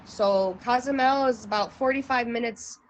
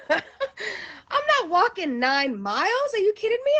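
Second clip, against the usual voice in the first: incredulous protest; 8 semitones higher than usual. Retold or imitated. Telling the guy she won't walk that far, high-pitched incredulous. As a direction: act out a stunned refusal. Retelling her shock at the distance.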